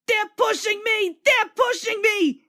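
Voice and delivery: high, loud frightened engine voice; panicked panting. A narrator's reading. Frightened James panting as the trucks push him. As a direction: gasp it out in terror. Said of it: "he panted".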